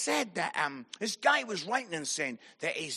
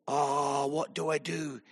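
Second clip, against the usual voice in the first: low, softer thinking to oneself; retold inner debate; 5 semitones lower, 5 dB quieter than usual. Sitting there thinking what to do, low musing. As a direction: weigh it up privately, voice dropped.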